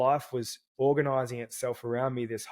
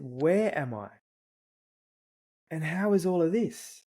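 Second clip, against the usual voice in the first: put-on higher, wondering child's voice; dad imitating his daughter asking from the car in a higher voice; little girl voice; 5 semitones higher than usual. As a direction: ask it like a small girl gazing at the trees. Father voicing his little daughter.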